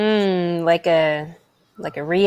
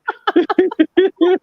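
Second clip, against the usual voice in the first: a high burst of real laughter; laughing hard and high; 11 semitones higher, 4 dB louder than usual. Real, not acted. Laughing after telling off a rival streamer, high laugh.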